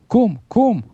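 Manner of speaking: high, pressing double command; urgent rousing call. A narrator's reading. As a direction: rouse a sleeper with a high call. Captain rouses sleeper in storm. (קריאת השכמה דחופה)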